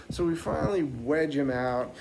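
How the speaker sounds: ordinary delivery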